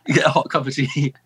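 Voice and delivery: laughing through "hot cup of tea" after catching himself. Retold or imitated. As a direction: laugh at his own Britishness mid-phrase. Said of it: "I'm so British".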